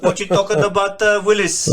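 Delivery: put-on TV catchphrase in a Filipino accent; high, loud Filipino-accented take on a catchphrase. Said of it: "change the whole thing".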